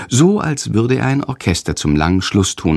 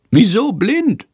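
high squeaky bat voice puzzling over blind; puzzled bat; 12 semitones higher, slower than usual. A narrator's reading. Little bat Sándor puzzled by the word blind. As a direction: squeak out a baffled question.

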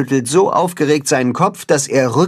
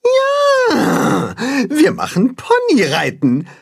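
much higher, joyful cry; happy cheer; 14 semitones higher, slower than usual. Acted. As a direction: cheer it, glad. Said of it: "freuen sich".

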